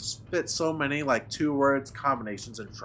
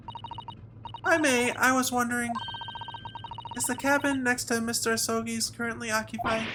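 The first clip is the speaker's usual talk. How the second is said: high, careful assistant voice; courteous assistant inquiring; 12 semitones higher than usual. Acted. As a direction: ask delicately as a polite helper.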